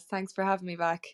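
ordinary delivery

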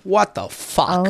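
drawn-out amazement; slow, astonished retold reaction; stunned disbelief. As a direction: stretch each word in disbelief. Amazed reaction to the wingman story.

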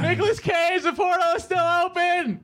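very high yelling, turned away from mic. Pretend. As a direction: yell from far off the mic. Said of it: "that's my yelling voice".

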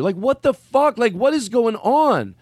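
high-pitched retold disbelief; exasperated attitude. Comic giving up on an unresponsive crowd, exasperated. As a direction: throw up your arms in exasperation. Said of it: "That was my attitude".

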